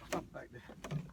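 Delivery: whispered under the breath, low; whispered aside. Real, not acted. Angler quietly tells himself where to drop, whispered. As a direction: breathe the words to yourself. Mutters instruction to himself, repeated.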